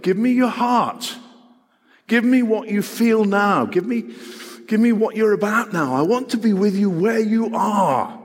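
urgent, high-pitched appeal; impassioned plea. Acted. Jesus pleading for people's hearts, impassioned. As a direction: plead with rising intensity, each request pressed home.

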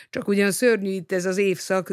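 plain reading voice

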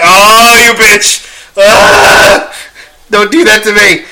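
hammed-up heartbroken protest; anguished outburst. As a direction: cry out as if the movie hurt you. Acting out his tearful theater reaction.

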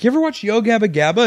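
high, eager parent voice; a hint of a gushing parent; mock enthusiastic parent. Mimicking a parent asking.

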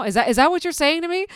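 high, fast, outraged question; mock-offended disbelief; hammed-up indignation; indignant disbelief. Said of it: "and I'm like".

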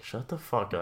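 retold quiet shutdown; deadpan retold put-down. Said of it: "I just looked her straight in the face".

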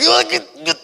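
excited cheering. Retold or imitated. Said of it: "I was like".

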